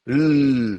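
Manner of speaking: "L" sustained as a long continuous l; held l sound. Shown as a demonstration. Teacher showing the class how an l can be lengthened. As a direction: hold the "L" out, no vowel after it. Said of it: "je peux l'allonger".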